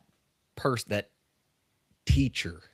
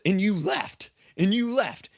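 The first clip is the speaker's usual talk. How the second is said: much higher, emphatic player voice; former player; 9 semitones higher, slower than usual. Retold or imitated. Former player tells coach he simply walked away, much higher. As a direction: repeat it with disbelief. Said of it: "And he said".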